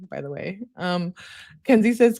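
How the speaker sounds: ordinary talk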